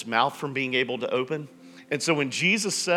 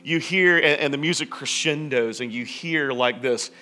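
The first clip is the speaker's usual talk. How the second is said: ordinary talk, nothing marked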